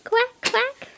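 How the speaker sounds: put-on high playful quack for a toddler; quacking duck. Shown as a demonstration. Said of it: "What does a duck say?".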